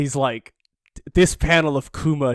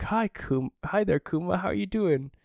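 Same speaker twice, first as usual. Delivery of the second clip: high, lightly voiced hello; soft-edged, higher greeting to a child; father greeting his son; 7 semitones higher, 3 dB quieter than usual. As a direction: lightly act a father's gentle greeting, pitched up.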